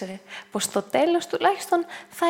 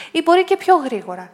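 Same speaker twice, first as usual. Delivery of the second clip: Dick, louder and higher; 5 semitones higher, 9 dB louder than usual. A narrator's reading. Dick's quote continues.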